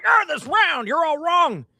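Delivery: mock grumpy old man insisting the earth is round; crotchety old man. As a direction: squawk it like a cranky old geezer. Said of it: "the old guy sitting there being like".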